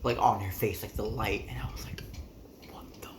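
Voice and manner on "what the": barely voiced, halting shock; stunned whisper. Shocked at seeing the phone.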